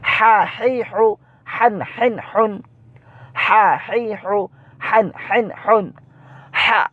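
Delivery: "হা" pushed from mid-throat, pitched high; high recitation with pressed pharyngeal h in "হান, হেন, হুন". Shown as a demonstration. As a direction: squeeze the h of "হা, হেই, হু" in the middle of the throat. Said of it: "গলার মধ্যখান থেকে উচ্চারিত হয়".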